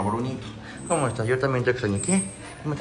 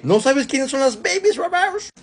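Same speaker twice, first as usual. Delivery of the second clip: much higher, slow sing-song pet voice; 14 semitones higher than usual. Real, not acted. Vet coos the puppies' name to them in high baby talk.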